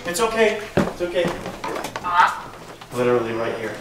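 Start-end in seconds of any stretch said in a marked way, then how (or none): 0.1-1.2 reassuring. Real, not acted. Calming after ball falls.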